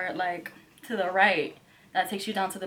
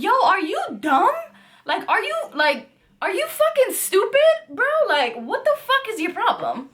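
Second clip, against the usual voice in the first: retold screaming; 10 semitones higher than usual. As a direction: scream at him at the top of your lungs. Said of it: "we're screaming at the top of our lungs".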